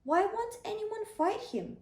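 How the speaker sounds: high, outraged why; indignant question. Acted. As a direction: ask as if you can't believe it. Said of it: "asked David".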